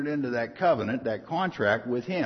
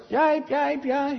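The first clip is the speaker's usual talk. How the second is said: very high yelping yipe; dog yelp imitation; 13 semitones higher, 3 dB louder, much slower than usual. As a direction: yelp like a little dog sent flying. Dog kicked away yelping.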